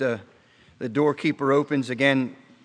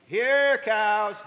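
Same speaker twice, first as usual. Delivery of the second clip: calling yell; 8 semitones higher than usual. Retold or imitated. Rancher's hand calling the herd to breakfast, high hollered call. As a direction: call them in across the pasture. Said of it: "And I would yell".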